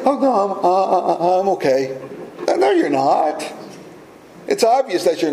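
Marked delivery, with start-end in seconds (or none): 0.1-2.0 flustered denial. Retold or imitated. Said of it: "somebody will say". 2.5-3.6 higher and slow, gently calling the bluff; knowing retort. Retold or imitated. Friend sees through denial.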